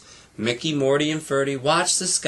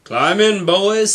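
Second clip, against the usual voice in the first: louder call to climb in; 6 semitones higher, 7 dB louder, slower than usual. Acted. Mickey calls the boys into the cockpit, raised voice.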